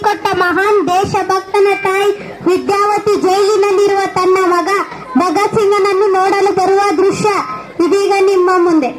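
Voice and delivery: loud, formal scene announcement; stage announcer. A narrator's reading. Announcer introducing the scene to the audience, loud and formal.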